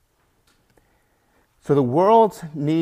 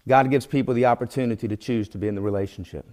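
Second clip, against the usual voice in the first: ordinary delivery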